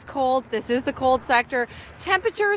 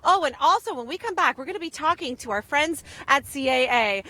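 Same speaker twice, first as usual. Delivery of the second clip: ordinary talk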